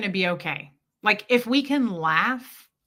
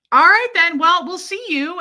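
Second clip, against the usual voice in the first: high, loud, hurried goodbye; embarrassed chirpy farewell, much higher and louder; flustered exit; 9 semitones higher, 8 dB louder than usual. Retold or imitated. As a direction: bluster out of the awkward moment. Awkward exit after blunder.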